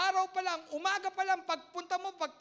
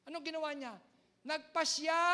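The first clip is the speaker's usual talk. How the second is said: normal talking voice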